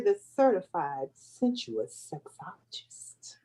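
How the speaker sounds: soft, slow breathy tease; sexy whispered title; sultry whisper. Acted. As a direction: whisper it seductively. Introducing the sexologist cohost.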